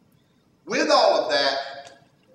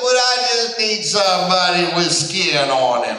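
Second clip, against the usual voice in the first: put-on louder, childlike reasoning; lightly voiced little girl; 7 dB louder than usual. Daughter explaining to dad what she needs, lightly childlike. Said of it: "She said".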